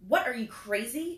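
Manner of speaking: loud, high shocked yell. A narrator's reading. Christopher yelling at the narrator in alarm, loud and high. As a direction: shout it out in alarm. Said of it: "Christopher yelled".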